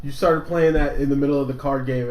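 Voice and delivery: usual speaking voice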